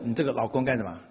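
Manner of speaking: ordinary talk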